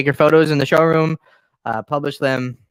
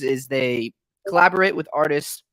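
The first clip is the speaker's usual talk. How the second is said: ordinary talk, nothing marked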